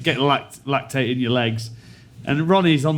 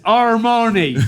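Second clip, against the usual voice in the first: higher, louder, overpronounced "Armani"; imitating him; 7 semitones higher, 4 dB louder, much slower than usual. Player repeating his brand name to the hotel woman, louder. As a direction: say "Armani" slowly to a foreigner. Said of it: "He said".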